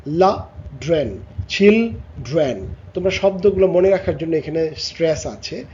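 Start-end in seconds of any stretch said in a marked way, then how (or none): 0.1-2.7 "children" taken apart slowly, piece by piece; broken into syllables. Shown as a demonstration. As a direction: sound out "children" with gaps between the parts. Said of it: "তিনটা ভাগে উচ্চারণ হয়". ("Children" ভাগে ভাগে, ধীরে)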